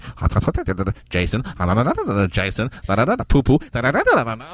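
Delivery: loud gibberish chant of relatives teasing; mock Korean chatter. Mom's Korean relatives teasing the boy, loud mock chatter. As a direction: imitate relatives teasing in a language you don't know, loud. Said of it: "they're like".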